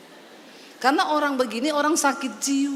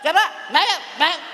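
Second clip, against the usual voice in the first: bossy lady of the house; 2 semitones higher, 4 dB louder, faster than usual. Retold or imitated. Preacher imitating a rude woman ordering guests, curt commands. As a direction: order the guest around like a snappy hostess. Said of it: "Lah, model ibu begini".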